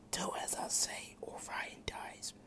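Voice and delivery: soft, breathless whispering demon; demon king whisper. A narrator's reading. Demon king whispering inside Drake's head, menacing whisper. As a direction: hiss it like a voice inside his head.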